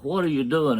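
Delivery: a touch of a holler; mild retold holler. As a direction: imitate a startled wife calling out, held back. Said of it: "she hollered".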